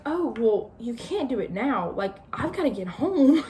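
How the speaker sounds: mock frantic protest; frenzied. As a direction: panicky girl insisting she must get home. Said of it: "she kinda gets a little frenzied".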